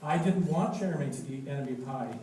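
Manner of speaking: usual speaking voice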